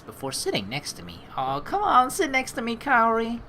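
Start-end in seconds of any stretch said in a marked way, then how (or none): 1.4-3.4 high playful coax. Acted. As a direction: tease her to sit closer. Coaxing the girl.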